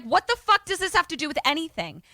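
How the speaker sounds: high, fast exasperated yell. Retold or imitated. Andy yelling at the agent, fast and high. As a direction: fire it off in outrage. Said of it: "And Andy yells".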